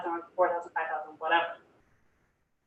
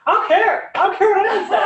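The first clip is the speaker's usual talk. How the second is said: much higher and louder, laughing defiantly; carefree teammate; 11 semitones higher, 8 dB louder than usual. Retold or imitated. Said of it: "He'll be like".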